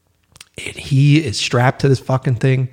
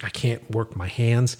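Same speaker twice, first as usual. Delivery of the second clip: groggy half-asleep; 7 dB quieter than usual. Retold or imitated. Just woken in the night.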